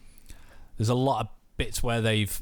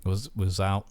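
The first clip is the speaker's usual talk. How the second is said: normal talking voice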